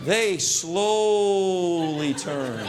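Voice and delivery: very slow, dramatic movie narration; slow-motion reunion narration. Acted. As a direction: stretch it like a film scene.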